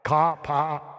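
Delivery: amateur Boston accent imitation; attempted Boston accent. Shown as a demonstration. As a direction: try it like a Bostonian. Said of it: "Does that sound like Boston?".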